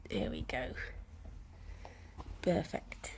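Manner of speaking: low, near-whispered murmur; hushed to pet. Real, not acted. Owner settling her pet, hushed and low. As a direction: murmur it under your breath.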